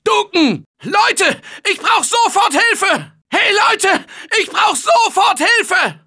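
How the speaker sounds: high, desperate yells for backup; frantic battlefield shouting; urgent shouting for help. Acted. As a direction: scream at the team to move. Calling urgently for help under attack.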